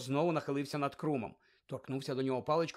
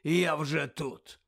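slow hoarse growl; slow hoarse growl of an old auror; 5 dB louder, much slower than usual. Acted. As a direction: growl it out gruffly, slowly. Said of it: "пролунало хрипке гарчання".

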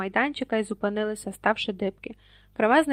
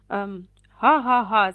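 hesitant fake laugh; 8 dB louder, much slower than usual. A narrator's reading. Harry stammering, fake laugh.

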